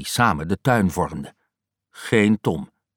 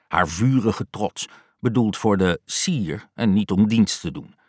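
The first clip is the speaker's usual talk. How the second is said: ordinary delivery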